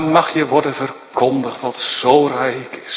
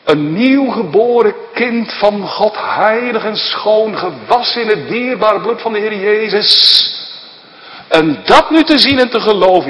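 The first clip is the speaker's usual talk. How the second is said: lifted preaching; 7 semitones higher, 5 dB louder than usual. Acted. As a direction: lift the voice to praise the cleansing. Raised preaching passage.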